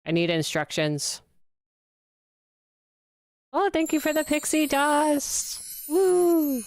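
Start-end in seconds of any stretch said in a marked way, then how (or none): 3.5-6.6 excited thanks. Real, not acted. Thanking for a gift on stream.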